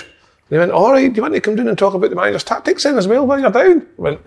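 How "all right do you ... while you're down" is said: higher mock chairman voice; a light take on a smooth club chairman; imitating the chairman. Chairman's offer, retold on phone.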